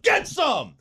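high, strained holler; yelled home-run call. Shown as a demonstration. Batter celebrating a big hit, high yell. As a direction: holler it out as a triumphant taunt. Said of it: "I yell out".

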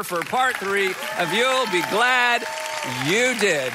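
grand stage introduction; announcer build-up. Acted. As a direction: hype the guest's name like an emcee. Pastor announcing guest speaker.

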